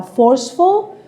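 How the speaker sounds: ordinary talk, nothing marked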